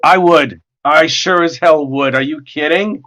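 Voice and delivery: ordinary talk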